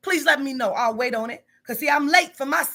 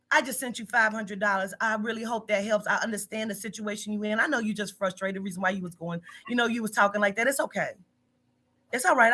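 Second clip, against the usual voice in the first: lower, softer, gentle tone; soft reassuring quote; 5 semitones lower, 5 dB quieter than usual. Retold or imitated. Boss quoting her own kind text, soft and reassuring. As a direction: soothe a frustrated worker. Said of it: "And then I said".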